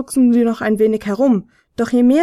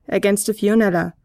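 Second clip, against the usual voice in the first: plain reading voice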